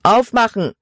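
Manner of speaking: high-pitched, forceful command; man calling out. A narrator's reading. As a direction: demand entry through a closed door. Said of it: "rief ein Mann".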